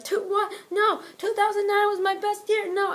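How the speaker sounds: high, loud crying protest; hammed-up desperate wail; mock panic. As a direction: beg not to go back. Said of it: "Kofi Kingston was like".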